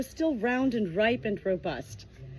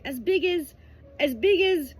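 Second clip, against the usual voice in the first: higher, hesitant sheep voice, groping; searching for words; 9 semitones higher, 5 dB louder than usual. Acted. Cloud hunting for something big, higher and halting. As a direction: stall while looking around. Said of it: "she looked around for something big".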